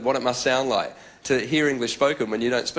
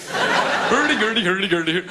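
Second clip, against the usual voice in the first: high, slow sing-song hurdy-gurdy; Swedish chef; 6 semitones higher, 3 dB louder, slower than usual. Retold or imitated. Audience doing the Swedish chef voice, sing-song. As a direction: imitate the Muppet Swedish chef. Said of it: "their own Swedish chef impersonation".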